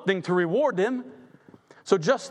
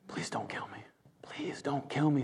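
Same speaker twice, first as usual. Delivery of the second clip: a desperate, much softer whisper; pleading whisper; 6 semitones lower, 10 dB quieter than usual. Acted. Queen Esther begging the king for her life, whispered. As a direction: beg for your life under your breath.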